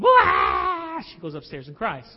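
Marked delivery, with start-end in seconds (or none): 0.0-1.0 loud, high hammed-up child's wail; a daughter wailing after being refused; mock wail. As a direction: let out a fake bawling wah. Child cries going upstairs.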